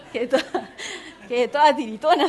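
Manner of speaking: words pushed out through laughter; laughing. Real, not acted. Preacher admitting she was left trembling, laughing. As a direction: keep telling it while laughing. Laughing while recalling her scare.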